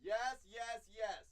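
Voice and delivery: loud, high cheering; excited cheering. Real, not acted. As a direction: cheer like a goal.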